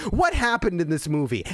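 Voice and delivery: usual speaking voice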